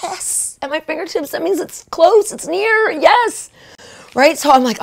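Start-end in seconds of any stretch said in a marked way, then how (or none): 0.0-1.3 awed whisper. Retold or imitated. Hearing God say she struck oil, whispering in awe. 1.3-3.5 higher, rising thrill; excited. Retold or imitated. Telling herself the promise is near, growing excited.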